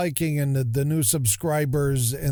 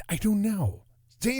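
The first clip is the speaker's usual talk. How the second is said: ordinary delivery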